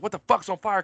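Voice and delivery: spotting smoke on break, yelling in alarm; retold panic. Panicked high cry. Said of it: "And I start freaking".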